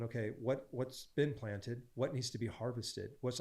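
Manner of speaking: soft, slowed questions to God; hushed porch talk with the Lord; quiet musing. Retold or imitated. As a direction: wonder aloud softly, as if in prayer.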